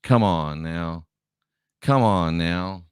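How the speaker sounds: low, slow "come on now"; dismissive. Real, not acted. Host brushing off claims of discrimination, dismissive.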